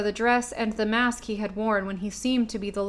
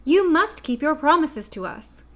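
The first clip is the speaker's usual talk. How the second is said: exclaiming; 6 semitones higher, 4 dB louder than usual. A narrator's reading. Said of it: "exclaimed Dorothy".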